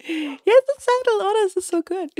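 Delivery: high, loud gleeful burst; real giddy triumph; overjoyed. Real, not acted. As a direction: celebrate in a delighted high pitch. Celebrating tamed donkey.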